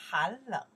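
han leng said loud, han falling; model pronunciation. Shown as a demonstration. Teacher modelling the word han leng, loud. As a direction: drill han leng for the class. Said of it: "We say".

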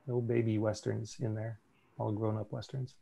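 ordinary delivery

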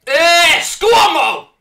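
loud high celebration cry; triumphant shout. Real, not acted. Gamer celebrating his goal at the screen, roaring. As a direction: roar the player's name.

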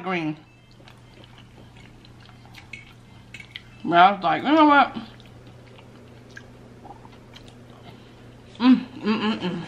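chewing between words; talking around food; mouth full. Real, not acted. Seen 0: Bite taken at 5.5s; mouth then appears working food while speaking.

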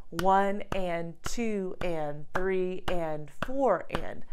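slow, even "one and two and" count; counting eighth notes. Shown as a demonstration. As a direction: count it out steadily, beat by beat. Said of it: "each eighth note equaling half of a beat".

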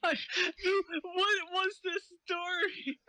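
high, slow fake crying; drawn-out mock weeping; mock sobbing. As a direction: wail it like a hammed-up sob.

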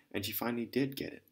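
ordinary delivery, nothing marked

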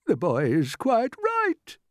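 slow, high, drawn-out pronouncement; old green grasshopper. A narrator's reading. Grasshopper backs James before the others, slow and assured. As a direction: declare it as a stately old grasshopper. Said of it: "the old green grasshopper said".

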